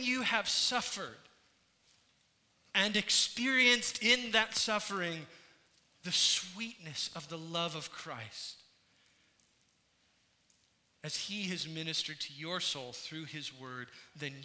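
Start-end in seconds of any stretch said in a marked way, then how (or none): none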